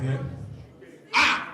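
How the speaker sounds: ordinary talk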